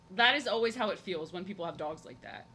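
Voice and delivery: ordinary talk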